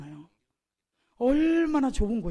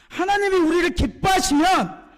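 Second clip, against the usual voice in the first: high, loud proclamation; bold confession; 8 semitones higher, 7 dB louder than usual. Retold or imitated. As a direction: declare faith at full voice. Joshua and Caleb's bold confession voiced.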